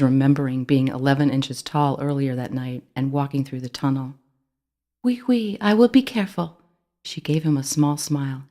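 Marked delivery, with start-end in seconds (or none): 5.0-6.6 put-on high, light voice with a French accent; a little French mouse promising, French accent. A narrator's reading. Ellie reassures Timothy, high little French accent.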